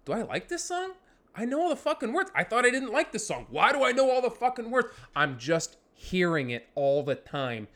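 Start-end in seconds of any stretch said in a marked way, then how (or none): 0.0-4.9 high-pitched, bewildered inner debate; baffled inner monologue, pitched up; puzzled self-questioning. Acted. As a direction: question yourself in a higher voice. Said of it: "you start thinking to yourself".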